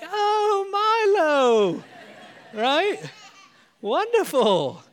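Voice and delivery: high, loud, drawn-out mock-delighted mum; gushing British mum. Mother praising son's tiny part, high gushing mum voice. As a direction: gush over him like a doting mother. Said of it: "she was like".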